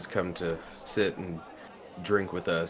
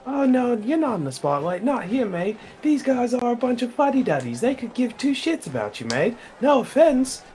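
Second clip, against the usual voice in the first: higher, friendly Aussie-ish barkeep; chummy barkeep voice; 4 dB louder than usual. Acted. Bartender chatting up a stranger at the bar, high and chummy. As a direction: play the chatty bartender calling everyone mate. Same character voice.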